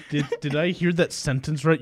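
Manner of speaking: normal talking voice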